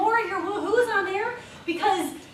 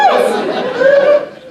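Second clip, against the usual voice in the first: high, loud cheer; whooping call; 9 semitones higher, 11 dB louder than usual. Pretend. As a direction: shout it like a fan in the stands. Calling out cheer.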